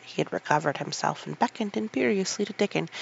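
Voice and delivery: ordinary delivery, nothing marked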